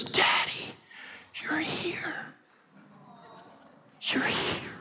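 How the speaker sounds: put-on breathy hushed little-girl whisper; imitated toddler whisper. Preacher imitating his daughter whispering when he walks in. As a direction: whisper it, delighted he came. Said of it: "she goes".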